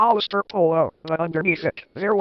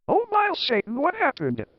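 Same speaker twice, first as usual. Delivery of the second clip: mock scream; 8 semitones higher than usual. A narrator's reading. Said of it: "I screamed".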